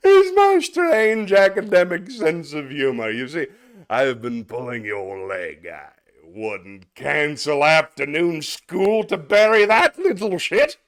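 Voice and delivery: a stuffy schoolmaster addressing a parent; prim, self-satisfied headmaster voice; pompous headmaster. Acted. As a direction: play the smug headmaster lecturing the father. Reads Atkinson headmaster sketch aloud.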